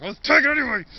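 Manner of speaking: dad loudly decides to take the couch; loud exclaim. Retold or imitated. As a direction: announce it boldly. Dad grabbing another couch.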